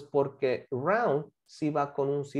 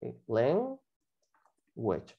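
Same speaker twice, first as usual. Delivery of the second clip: slow model word; 5 semitones lower, 5 dB quieter, slower than usual. Shown as a demonstration. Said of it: "¿Y cómo se dice eso?".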